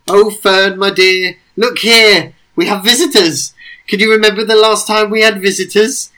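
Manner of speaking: high, loud, doting greeting; doting excited call. Acted. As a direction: call out fondly to a pet. Sir Lucia cooing to Fern.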